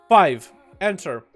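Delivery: usual speaking voice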